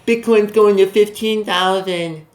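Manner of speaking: slow, whiny mock panic; mocking panicked viewers. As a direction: parody a scared investor. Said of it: "you guys are all panicked now".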